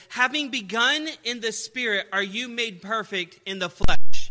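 loud, high preaching of the verse; preaching scripture. Acted. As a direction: proclaim the scripture to the church. Preacher reads scripture aloud.

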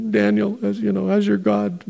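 a hint of a grieving king calling out; lamenting king. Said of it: "so he has this lamenting voice".

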